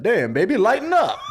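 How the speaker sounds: loud, rising laid-back tease; cool-guy skeleton. Acted. The skeleton husband teases his wife, loud and rising. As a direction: tease her like a smooth dude. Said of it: "he's like".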